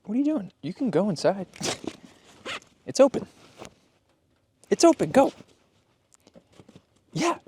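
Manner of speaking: high, coaxing call to the dog. Real, not acted. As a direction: coax the dog inside. Urging dog to go inside.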